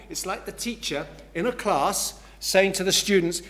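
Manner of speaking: usual speaking voice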